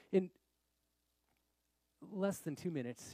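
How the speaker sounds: normal talking voice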